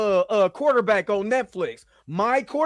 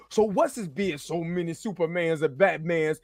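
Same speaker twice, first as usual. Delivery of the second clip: flat reading of comment; 6 semitones lower, 4 dB quieter than usual. Retold or imitated. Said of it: "I'ma read it just like".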